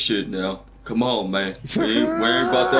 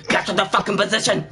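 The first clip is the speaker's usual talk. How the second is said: raised-pitch game order; hammed-up urgent order; mock shouted command; 7 semitones higher than usual. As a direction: call it out to the whole team. Re-enacting annoying game callouts.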